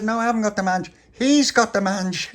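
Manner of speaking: high, loud indignant protest; indignant denial. Acted. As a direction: shout it down, offended. Tony denies, blames friend.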